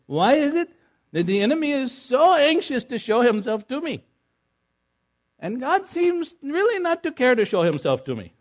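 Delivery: high, loud, pleading question; baffled friend. Retold or imitated. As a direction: lift way up, bewildered and urgent.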